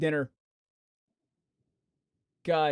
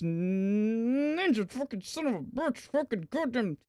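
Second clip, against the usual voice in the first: slow, high, softer venomous grumble; muttered curse; 5 semitones higher, 6 dB quieter, slower than usual. Real, not acted. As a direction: spit it under your breath. Erasing contact, cursing.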